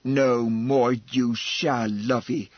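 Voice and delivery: low, slow, loud and heated; hot declaration. Acted. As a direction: declare it hotly in her defence. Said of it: "hotly declared Missus Flor".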